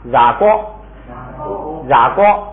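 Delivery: 染锅 read out as a teacher's model; syllables read aloud. Shown as a demonstration. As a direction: say 染锅 clearly for the class to repeat. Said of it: "大家读一下啊". (老师领读染锅)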